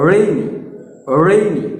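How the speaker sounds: রেইনি with a curled, rounded r; demonstrated English r. Shown as a demonstration. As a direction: round into the r, then glide "ei" in রেইনি. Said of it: "দেখো আমি করছি".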